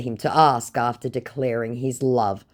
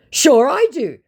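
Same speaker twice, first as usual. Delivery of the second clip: high, loud put-on voice; quick fake assurance; hasty mock scammer; 13 semitones higher, 8 dB louder than usual. Scammer hastily assuring her in a high mock voice.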